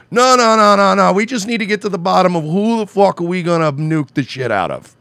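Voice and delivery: high, loud frantic nuke-hungry voice; mock warmonger. Host mocking politicians, bloodthirsty yelling. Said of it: "now it's like".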